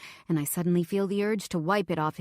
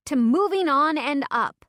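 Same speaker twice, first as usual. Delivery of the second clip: raised, loud toast; tipsy narrator toasting her escape; cheerful toast; 7 semitones higher, 6 dB louder than usual. Acted. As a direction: lift the glass and cheer.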